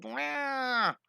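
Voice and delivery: long, high wail; startled cat yowl. Retold or imitated. Drawing teacher voicing the startled cat, high drawn-out wail. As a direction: imitate a startled cat's cry. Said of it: "It's all startled".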